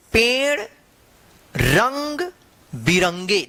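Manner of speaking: slow story reading, one word at a time; reading to children. Acted. Teacher reads the story to children, slow and word by word. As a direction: read it out word by word so kids follow.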